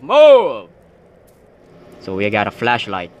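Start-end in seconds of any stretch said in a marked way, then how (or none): 0.0-0.7 loud urgent shout. Real, not acted. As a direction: yell at them to move. Urgent calling out in game.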